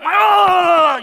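sudden high loud startled oh; startled cry. Retold or imitated. Pastor startled by a yell before service, loud cry. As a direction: jump out of your skin.

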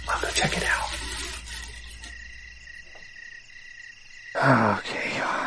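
nervous whisper; hushed, steeling yourself. Acted. Whispered night scare skit.

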